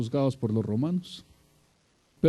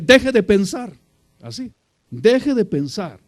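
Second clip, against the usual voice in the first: loud, high-pitched yell at the worker; retold shout; 7 semitones higher, 8 dB louder than usual. Preacher retells yelling at the worker, loud shout. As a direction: shout it to make him stop thinking. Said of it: "hasta que le grité".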